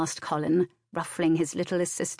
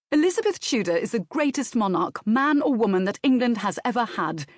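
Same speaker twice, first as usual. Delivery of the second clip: emphatic, raised-pitch declaration; vehement; 6 semitones higher, 4 dB louder, faster than usual. A narrator's reading. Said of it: "said Colin Saint Michael vehemently".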